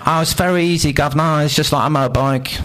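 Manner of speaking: high, breezy Cockney bloke; mock Cockney mechanic. Mechanic explains the machine.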